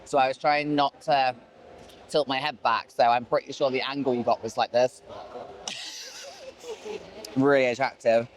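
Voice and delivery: ordinary talk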